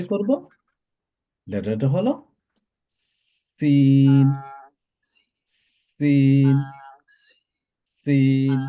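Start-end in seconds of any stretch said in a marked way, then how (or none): 3.6-8.6 "সিন" with a sibilant whistling S, loud and drawn out; hissing S. Shown as a demonstration. Teacher models the letter for students, "সিন" with hissing S. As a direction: hold "সিন" on an even tone, hissy S. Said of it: "একটু চিচির মতো শব্দ হবে".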